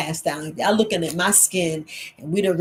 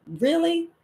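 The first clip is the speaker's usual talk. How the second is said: incredulous; 10 semitones higher than usual. Retold or imitated. Incredulous reply to "quack" claim.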